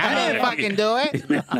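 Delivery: slow, put-on protest with a laugh; half-laughing mock denial; mock waitress denial. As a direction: imitate her denying it, drawn out. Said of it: "She's like".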